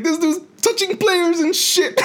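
high, drawn-out mock outrage; joking accusation. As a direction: tattle on a friend in jest, slow and very high. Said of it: "I was doing it in a joking way".

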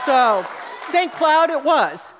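drawn-out victorious declaration; triumphant punchline. Real, not acted.

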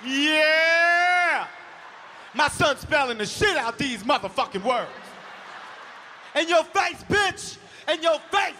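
loud high cheering voice; cheering dad. Retold or imitated. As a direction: whoop it up like a sports dad. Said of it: "all I heard was".

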